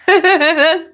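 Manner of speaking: bursting into a high laugh. Real, not acted. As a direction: laugh through the word, high and loud. Laughing at own story.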